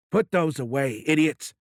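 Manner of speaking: loud commanding bark at his own men; angry shout. Acted. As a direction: yell the order, furious. President barks at armed guards.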